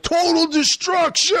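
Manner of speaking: high, hammed-up battle cry; over-the-top boastful yell; mock macho shout. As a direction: imitate a muscleman bellowing for the camera. Imitates muscle man's video.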